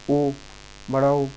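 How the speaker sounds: ओ said with lips rounded into an o; rounded o sound. Shown as a demonstration. Teacher shows students the rounded ओ, lips rounded. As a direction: round the mouth for ओ. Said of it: "मुंह गोल होगा".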